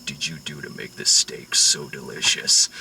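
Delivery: mock-threatening mob boss asking about steak; menacing Whitey. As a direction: lean in low and deadly over dinner. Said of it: "Whitey's like".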